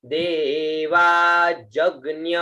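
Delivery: देवा जग्ञ said slowly, ज्ञ clear; slow pronunciation. Shown as a demonstration. Teacher models देवा जग्ञ slowly. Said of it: "इसको ज्ञ का".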